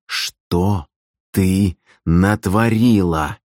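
slow, word-by-word demand; pressing demand. A narrator's reading. Brother pressing his evasive sister, slow deliberate demand. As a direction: space out every word, pressing hard.

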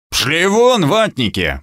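mild growled dismissal, pitch leaping then dropping; understated angry snarl. A narrator's reading. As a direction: send them off with a restrained growl. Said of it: "прорычал обезьян".

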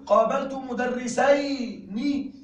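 slow, clear reading of مدرسين; slow teaching example; slow model sentence. Shown as a demonstration. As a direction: read مدرسين slowly, keeping the final nun. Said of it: "كذلك أقول".

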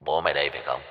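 soft, low, cold sneer; sneering taunt. A narrator's reading. Kidnapper sneering as he shows the photos, low and soft. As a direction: twist the knife quietly. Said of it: "Hắn cười gằn".

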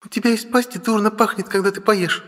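put-on high, pouty, whiny girl; capricious pout. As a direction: sulk with pouting lips. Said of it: "капризно надувает губки".

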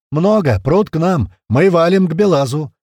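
loud, high, urgent bursts; explosive alarm. A narrator's reading. Scout reporting an onrushing enemy by radio, explosive alarm. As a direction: shout a retreat warning. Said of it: "Внезапно взорвалась рация голосом Крота".

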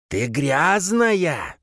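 slow, high accusation; fanatic's accusing hiss. A narrator's reading. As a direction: draw it out in a thin zealot voice. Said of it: "зашипел на неё худощавый". (протяжное, высокое обвинение фанатика)

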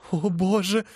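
high, pleading cry to God; anguished cry. Acted. Phoebe on her knees crying out to God. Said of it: "кричала она".

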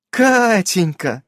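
slow, high, loud delighted cry; delighted neighbour. A narrator's reading. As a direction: gush over the girl's name. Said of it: "обрадовалась соседка".

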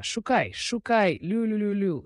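high, excited hunting call; urging the hound. A narrator's reading. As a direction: send the dog off to seek. King sending hound to seek.

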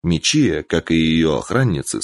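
ordinary delivery

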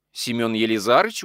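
usual speaking voice

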